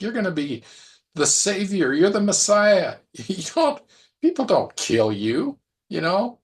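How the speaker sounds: high, loud, incredulous rebuke; acted-out exasperated telling-off; indignant scolding. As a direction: chew him out like he's got it all wrong. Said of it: "he rebukes him, chews him out".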